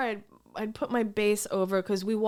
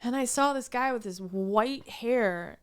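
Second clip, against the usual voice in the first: usual speaking voice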